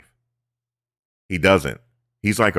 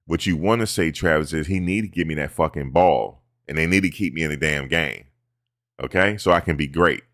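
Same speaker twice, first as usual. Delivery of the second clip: usual speaking voice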